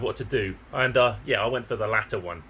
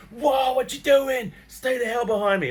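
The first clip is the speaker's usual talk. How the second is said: much higher, louder, slow alarmed call; protective warning; 11 semitones higher, 3 dB louder than usual. Retold or imitated. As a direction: stop her in her tracks. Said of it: "I'm like".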